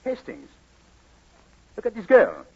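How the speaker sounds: ordinary delivery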